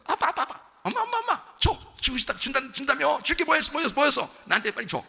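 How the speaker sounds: put-on high, giggly child nagging for a present; child pesters father, laughing; begging child. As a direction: whine cheerfully like a kid tugging at dad.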